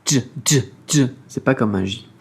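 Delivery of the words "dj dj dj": "dj" said as a hard d-j onset, repeated; pron demo. Shown as a demonstration. Teacher drills the Mandarin j sound, crisp and repeated. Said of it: "il se prononce un petit peu comme un D J".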